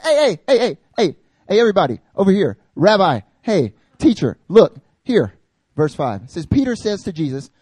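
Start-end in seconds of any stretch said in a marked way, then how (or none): 0.0-5.3 higher and louder, pestering repeated heys; eager calling out. Retold or imitated. Peter interrupting the transfiguration, pushy loud calling. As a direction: keep grabbing everyone's attention. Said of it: "He said".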